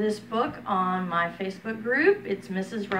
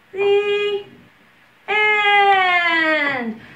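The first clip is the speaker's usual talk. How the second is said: slow, lilting storybook voice; reading to children; 3 semitones higher, slower than usual. Acted. Preschool teacher reading a picture book to her class, lilting. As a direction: read it like circle time, slow and sing-song.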